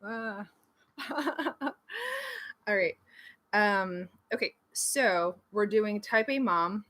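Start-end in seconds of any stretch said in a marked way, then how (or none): 1.0-2.6 high burst of real laughter; light, high-pitched laugh. Real, not acted. Streamer amused at rambling about glasses, laughing.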